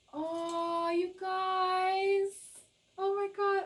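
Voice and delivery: high, loud squeal of delight; excited squeal. Real, not acted. Tarot reader thrilled by the cards for viewers, squealing high and loud.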